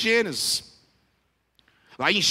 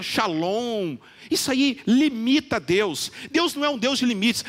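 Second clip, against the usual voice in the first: normal talking voice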